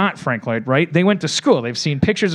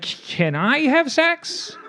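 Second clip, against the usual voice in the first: slow, squeaky customer; 11 semitones higher, much slower than usual. Acted. A new customer asks.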